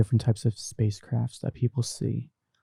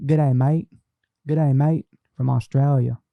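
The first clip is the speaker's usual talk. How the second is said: Aussie greeting; 3 semitones higher, 5 dB louder than usual. Retold or imitated. Repeating the Australian greeting.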